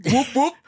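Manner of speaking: high loud whooping; whoop call. Retold or imitated. Imitating whoop vocalizations.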